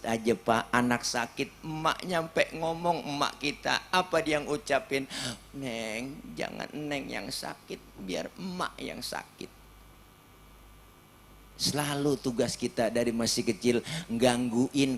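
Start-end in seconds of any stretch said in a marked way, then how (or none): 5.5-9.5 tender whisper. Retold or imitated. Mother soothing her sick child.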